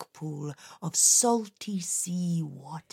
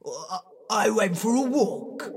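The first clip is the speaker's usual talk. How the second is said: stammering guilty excuse; nervous stammer; 3 semitones higher, 3 dB louder than usual. Acted. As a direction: stumble over the lie. Caught monster stammering a lie.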